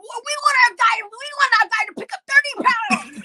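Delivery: high, loud imitation of complaining fans; mock outraged fans. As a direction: whine loudly like a fan demanding an unfair edge. Mimicking upset fans' demands.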